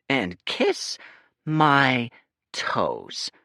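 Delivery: kiss my toes spaced out, smug; smirking, slow. Acted. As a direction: smirk through each word. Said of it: "He smirked as he said it".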